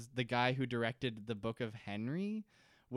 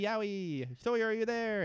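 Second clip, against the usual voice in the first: much higher mock whimper calling out; mock pained calling; 9 semitones higher than usual. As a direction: whine like a hurt kid calling for a friend. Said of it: "He goes".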